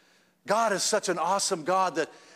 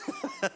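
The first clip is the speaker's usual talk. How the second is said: real laugh; 6 dB quieter than usual. Real, not acted. Laughs at timid altar workers.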